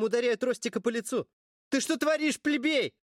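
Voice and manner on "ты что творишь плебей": high, furious shout; angry yell. A narrator's reading. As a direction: snap at him in outrage. Character yelling in a fight.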